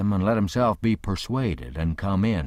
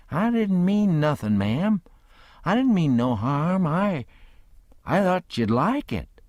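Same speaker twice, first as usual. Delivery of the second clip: put-on meek, apologetic boy, higher; humble; 8 semitones higher than usual. A narrator's reading. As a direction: protest innocence sheepishly. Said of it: "He looked kinda humble".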